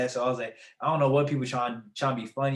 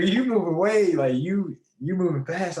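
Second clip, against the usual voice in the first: higher, louder panicked protest; alarmed protest; 7 semitones higher, 6 dB louder than usual. Retold or imitated. Son protesting to his mom that she's moving fast, alarmed. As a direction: push back fast, alarmed. Mom says he's leaving today.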